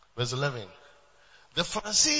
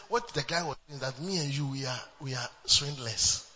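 ordinary talk, nothing marked

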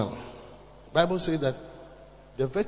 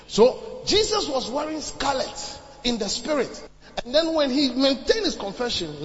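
loud, raised-pitch sermon delivery; impassioned preaching; 6 semitones higher, 5 dB louder than usual. Acted. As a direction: preach it forcefully to the congregation. Preaches at full force.

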